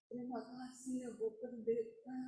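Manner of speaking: very soft, hushed thanks; imitated grateful thanks. Woman thanking the pastor after prayer, imitated softly. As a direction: drop right down as the relieved woman.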